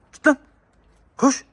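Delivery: normal talking voice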